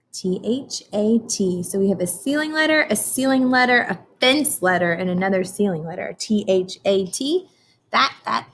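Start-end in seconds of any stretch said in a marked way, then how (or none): 0.1-1.6 slow, T H A T spelled letter by letter; spelling out letters. Shown as a demonstration. Teacher spelling a sight word for children, slow letter by letter. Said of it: "Can you say that?". 6.2-7.5 slow, T H A T spelled letter by letter; spelling out letters. Shown as a demonstration. Teacher spelling a sight word for children, slow letter by letter. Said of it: "Can you say that?".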